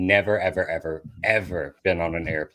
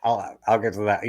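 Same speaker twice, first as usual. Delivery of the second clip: ordinary talk, nothing marked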